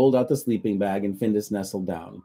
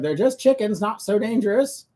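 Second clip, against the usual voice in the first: shouting; 12 semitones higher, 4 dB louder than usual. Acted. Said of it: "she shouted".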